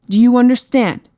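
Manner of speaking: irritated knight raising his voice; annoyed. A narrator's reading. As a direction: demand an answer impatiently. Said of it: "the annoyed knight exclaimed".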